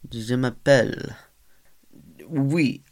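weak attempt at a French accent. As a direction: try a French accent, badly. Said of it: "That did not sound French".

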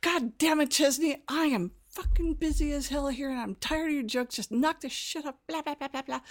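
high-pitched retold tirade; infuriated rant. Busy receptionist blowing up at suspected prankster, infuriated rant. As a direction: tear into the caller, exasperated and rising. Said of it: "And I was infuriated".

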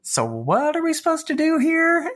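high, lost and puzzled voice; clueless. Retold or imitated. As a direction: play it baffled and helpless. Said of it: "Casey and I are like".

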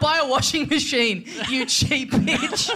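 slow, laughing mock scorn; laughing dig about tea towels; laughing insult. Real, not acted. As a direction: drag out the jab while laughing. Teasing co-host.

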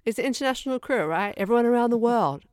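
building to high, loud incredulity; exasperated ranting. Real, not acted. Comic rant about faked accents.

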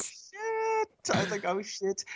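high, small squeak; retold dread. Prankster realising her lie backfired, soft high squeak.

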